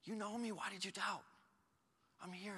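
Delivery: put-on calm him like a frightened child; Jesus soothing sinking Peter, softly; tender reassurance.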